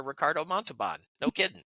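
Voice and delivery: normal talking voice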